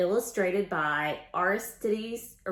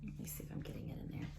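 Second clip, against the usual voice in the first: quick whispered aside to herself; fast, whispered self-talk; 15 dB quieter, much faster than usual. Real, not acted. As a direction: mutter it off to the side. Aside while adjusting book.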